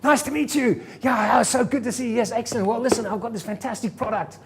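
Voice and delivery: high, loud, gushing salesman greeting; hammed-up eager salesman pitch; overdone cheerful sales greeting; over-the-top enthusiasm. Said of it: "he acted enthusiastic, like, over the top".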